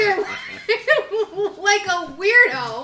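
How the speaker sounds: talking through loud laughter; laughing. Real, not acted. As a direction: laugh out the words. Teasing friend off camera.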